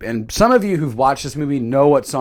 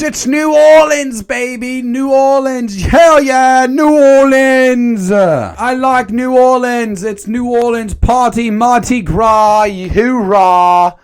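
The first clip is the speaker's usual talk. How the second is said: high, loud put-on Southern party accent; mystery party accent; 8 semitones higher, 6 dB louder, slower than usual. Announcing arrival in New Orleans, loud put-on accent. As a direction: hoot it out in a made-up accent. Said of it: "I don't even know what accent that was".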